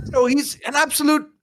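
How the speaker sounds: high-pitched objection cut short; mildly acted alarm; appalled outburst. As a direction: blurt it out, then catch yourself. [name] blurts disapproval of apprenticeship.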